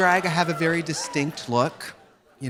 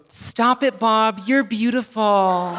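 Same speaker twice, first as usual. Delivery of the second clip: put-on girl gushing; 5 semitones higher, much slower than usual. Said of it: "And the girl goes".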